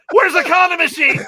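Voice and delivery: high, loud cry of alarm; acted frantic yell. Boy finding the condom machine gone, acted frantic yell. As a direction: play his panic at full volume.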